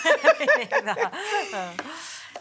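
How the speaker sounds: loud delighted laugh, high up; high burst of laughter. Real, not acted. Laughs at scientist joke.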